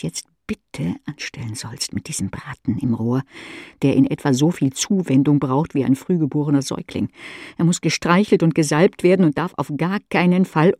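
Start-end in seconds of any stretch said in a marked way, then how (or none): none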